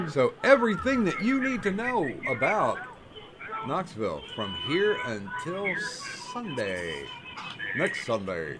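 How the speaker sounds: lively TV weatherman read with punched day names; sing-song forecast delivery; mock weather report. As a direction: deliver the forecast like a local broadcaster handing off to sports.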